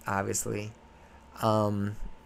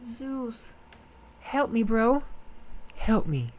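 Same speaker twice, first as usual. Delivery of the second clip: higher, whiny plea to a buddy; mock pleading goddess; 10 semitones higher, slower than usual. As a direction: beg Zeus like a desperate friend. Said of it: "So she's like".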